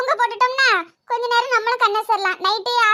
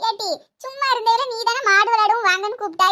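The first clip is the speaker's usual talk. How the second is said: ordinary delivery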